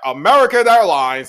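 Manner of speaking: loud, high call of American Airlines; excited call-out. Retold or imitated. Recognizing the airline in the movie, loud excited call. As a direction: shout the airline name like spotting a friend. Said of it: "I was like".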